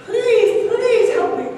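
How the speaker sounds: high, loud, begging cry; climber's final high loud plea to God; desperate pleading. Acted. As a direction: cry out for help in despair. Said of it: "He's really desperate".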